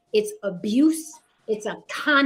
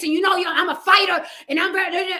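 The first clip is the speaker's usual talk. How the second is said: higher and louder, puffed-up fighter boast; mock tough guy swagger; 6 semitones higher, 4 dB louder, faster than usual. Mimicking a macho influencer's swagger to a podcast panel. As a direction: strut it like a macho alpha showing off. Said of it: "if I talk like this".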